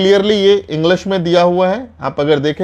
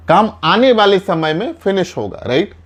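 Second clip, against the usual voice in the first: ordinary talk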